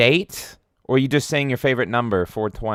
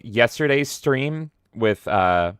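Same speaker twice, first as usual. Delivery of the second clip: usual speaking voice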